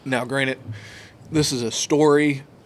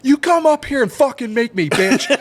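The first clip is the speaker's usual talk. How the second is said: high, loud shouted challenge; belligerent dad; 12 semitones higher, 6 dB louder than usual. Retold or imitated. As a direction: dare the ref like a hot-headed dad. Dad challenging the ref, retold.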